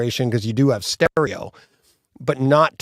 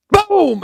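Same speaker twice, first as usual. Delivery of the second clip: a sudden, much higher, louder vocal gunshot; mouthed gunshot bang, loud and sharp; gunshot boom; 5 dB louder than usual. Retold or imitated. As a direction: spit out the blast of a rifle. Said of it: "and then you hear".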